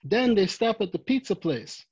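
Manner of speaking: ordinary delivery, nothing marked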